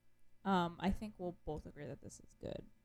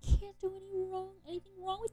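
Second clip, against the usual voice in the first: "can't do any wrong" pitched way up; high-pitched gushing repeat; 13 semitones higher, 3 dB louder than usual. Real, not acted. Co-host gushing over a favourite duo, high-pitched. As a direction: gush it in a high, held squeal.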